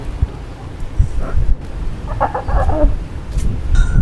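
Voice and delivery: giggling laugh; can't hold back a giggle. Real, not acted. Laughing at the phone game.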